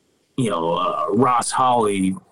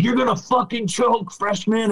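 high mocking jeer at a rookie; taunting opponent; 10 semitones higher than usual. Rival player taunting the freshman pitcher, high mocking jeer. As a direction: sneer it down at him from the dugout. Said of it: "he's like".

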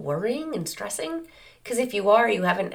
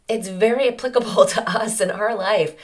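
ordinary talk

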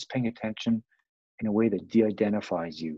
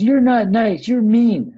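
put-on much higher, loud whiny kid wail; screaming child; 12 semitones higher, 10 dB louder, slower than usual. Child protesting parent, high loud mock wail. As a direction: shriek it like a toddler denied donuts. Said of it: "The kid starts screaming and crying".

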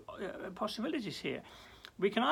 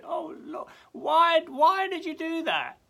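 high, loud pleading why; anguished plea; 10 semitones higher, 10 dB louder, slower than usual. Pretend. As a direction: cry out to God in pain. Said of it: "For, for do".

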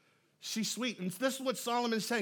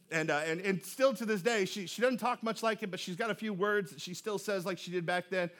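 normal talking voice

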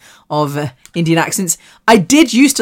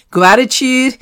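higher, questioning American accent; 7 semitones higher, slower than usual. Shown as a demonstration. Catching herself mid-podcast, questioning American accent. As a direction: echo the word in an American accent. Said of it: "Why did I talk in an American accent?".

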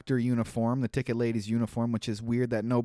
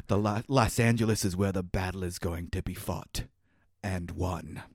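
low, stiff wooden line reading; cardboard cutout delivery; 7 semitones lower, 4 dB quieter than usual. Retold or imitated. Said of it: "He delivers it like he's a cardboard cutout".

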